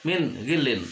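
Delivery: "min ghilin" with a short crisp n; "min ghilin", no hum on the n; clear idhar reading. Shown as a demonstration. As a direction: cut "min" cleanly before "ghilin". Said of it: "harus jelas".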